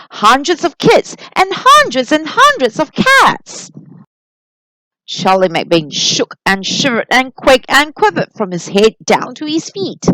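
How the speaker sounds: ordinary delivery, nothing marked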